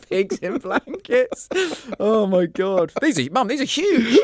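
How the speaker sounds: very high, slow, laughing disbelief; mock horrified laughing. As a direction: shriek at the sausages, laughing. Reliving Christmas dinner flashback.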